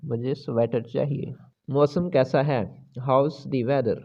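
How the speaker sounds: ordinary delivery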